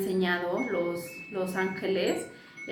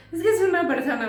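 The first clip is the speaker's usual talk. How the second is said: high, louder voice of the son, mimicked; mimicking her young son, higher and louder; son's puzzled reply; 9 semitones higher, 6 dB louder, faster than usual. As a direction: act out the boy insisting someone is there. Son explaining the unseen presence.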